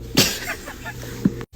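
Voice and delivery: high breathy laugh. Real, not acted. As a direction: laugh at yourself. Laughing at her own foolishness.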